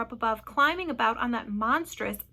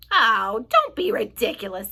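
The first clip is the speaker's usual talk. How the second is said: scornful telling-off; 9 dB louder than usual. A narrator's reading. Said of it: "the centipede told him".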